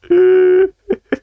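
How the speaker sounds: shrieking laugh; loud squealing laugh; loud high laugh. Real, not acted.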